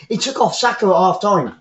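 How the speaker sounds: usual speaking voice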